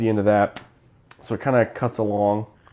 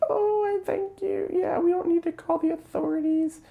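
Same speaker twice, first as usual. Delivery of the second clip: tiny, very high squeaky voice; mock sweet old woman in extreme falsetto; creepy old lady. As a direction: squeal it thinly, drawing out the thanks. Acting the creepy old woman's thanks.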